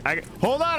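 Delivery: normal talking voice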